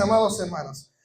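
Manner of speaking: ordinary talk, nothing marked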